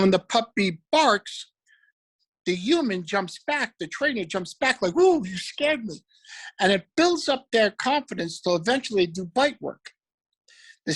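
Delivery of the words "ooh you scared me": mock scared. Trainer pretends fear of puppy.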